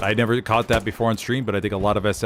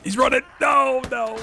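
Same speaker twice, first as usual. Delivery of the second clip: frantic game shout. Real, not acted. At the fleeing enemy, high panicked shout. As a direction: yell it as the enemy gets away.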